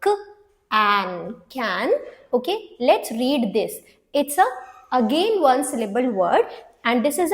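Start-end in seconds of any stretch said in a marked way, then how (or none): 0.1-1.3 "क, an" as two separate sounds; short vowel in "an", held apart from "क"; sounding out. Shown as a demonstration. Teacher reads a short vowel word, sounding out "क, an". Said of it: "तो इसे हम क्या पढ़ेंगे".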